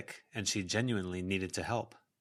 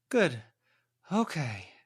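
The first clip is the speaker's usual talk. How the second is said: huffing; 6 semitones higher, 5 dB louder, slower than usual. A narrator's reading.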